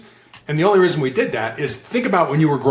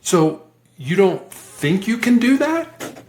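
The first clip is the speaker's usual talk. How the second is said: slow, higher-pitched questioning; curious; 6 semitones higher, slower than usual. Shown as a demonstration. Negotiator probing the other person, curious. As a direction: draw it out with a curious lift. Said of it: "we would always be curious".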